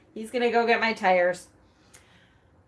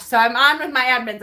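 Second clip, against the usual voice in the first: ordinary talk, nothing marked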